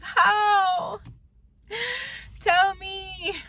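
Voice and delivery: high, loud, cracking with frustration; exasperated disbelief. Real, not acted. Mocking online success stories to viewers, cracking exasperated demand. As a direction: demand it, near tears.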